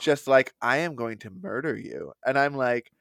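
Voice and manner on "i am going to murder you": low, softly spoken mock threat; quiet menace. Noticeably upset girlfriend to sobbing boyfriend, quietly menacing. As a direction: say it low, with a retold cold glare. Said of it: "she just starts glaring at him".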